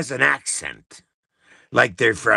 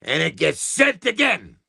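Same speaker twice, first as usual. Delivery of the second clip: normal talking voice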